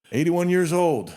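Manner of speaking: emphatic amazement. Real, not acted. Marvelling at guest's age.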